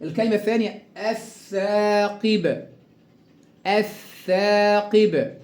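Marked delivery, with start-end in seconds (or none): 1.0-2.7 الثاقب with held double th and long aa; lam merged into th. Shown as a demonstration. Teacher drills the second word, lam merged into th. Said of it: "أضغمت اللام في الثاء المشددة". 3.7-5.3 الثاقب with held double th and long aa; lam merged into th. Shown as a demonstration. Teacher drills the second word, lam merged into th. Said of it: "أضغمت اللام في الثاء المشددة".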